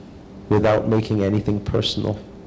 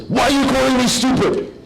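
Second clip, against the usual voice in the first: high, loud, indignant mock outburst; an affronted man shouting back at the heckler; offended retort; 4 dB louder than usual. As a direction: whip round and demand an answer.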